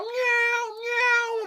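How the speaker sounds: very high, drawn-out meowing; mock cat meow. Person acting like a cat in the nut house, high meows. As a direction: wail like a pretend cat. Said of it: "if you start".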